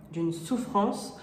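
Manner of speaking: ordinary delivery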